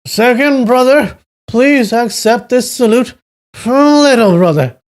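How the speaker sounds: put-on drunken shout, very high and loud; Duan Yu shouting. A narrator's reading. Little brother saluting his second brother, shouting drunkenly. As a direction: call out in tipsy jubilation. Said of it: "Duan Yu shouted".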